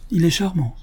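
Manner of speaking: a gentle high admiring "charmant"; lightly voiced fond praise; sincere and kindly. A narrator's reading. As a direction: say it as a kind old lady who means it. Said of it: "bienveillante et sincère".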